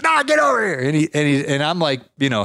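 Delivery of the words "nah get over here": mock yell. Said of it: "starts, like, yelling at me".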